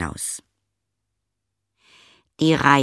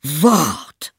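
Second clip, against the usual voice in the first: angry shout; 6 semitones higher, 5 dB louder than usual. Acted. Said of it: "rief er".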